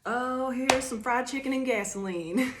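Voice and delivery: hammed-up Southern punchline; Southern drawl. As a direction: tease it out in a country accent. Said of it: "say you have a really thick Southern accent".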